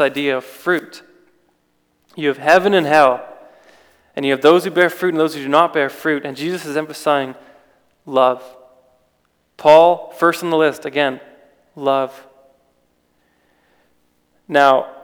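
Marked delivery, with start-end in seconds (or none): none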